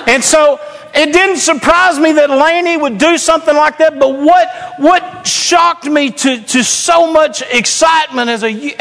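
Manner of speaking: high, driving pulpit delivery; fired-up preaching. Real, not acted. Proud pastor tells congregation about his teenagers, excited preaching.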